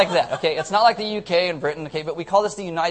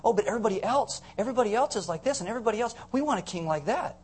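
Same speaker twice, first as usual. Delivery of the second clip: higher-pitched mild whine; pleading complaint; 6 semitones higher, 3 dB quieter, faster than usual. Acted. The people pestering God for a king, mildly whining complaint. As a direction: lightly voice an envious crowd complaining.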